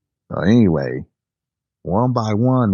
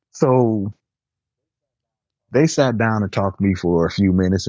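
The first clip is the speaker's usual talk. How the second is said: normal talking voice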